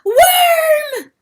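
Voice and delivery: very high, loud rooster scream; calling with all his might. Acted. Chanticleer calling to the Worm, full-force scream. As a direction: hurl the word across the sea. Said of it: "Chanticleer calling with all his might".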